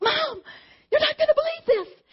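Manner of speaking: high, loud, breathless with excitement; excited daughter. Retold or imitated. Daughter bursting to tell mom, high and excited. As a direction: squeal the news out. Daughter calls with big news.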